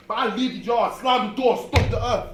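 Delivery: loud and heated. Acted. Storming out, loud.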